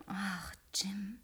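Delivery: breathy whispered sigh of his name. Acted. Woman whispers the man's name softly during the piano lesson.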